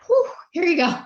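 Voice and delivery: loud, high mock whew and send-off; acted relief. Mom imagining the nanny's first day, acted relief. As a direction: play an exaggerated burst of relief.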